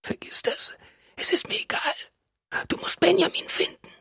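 weak, urgent whisper; whispered plea. A narrator's reading. Wife begs husband to find Benjamin, whispering. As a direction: press him in a whisper.

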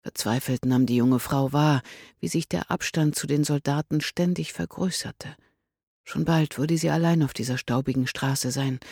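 usual speaking voice

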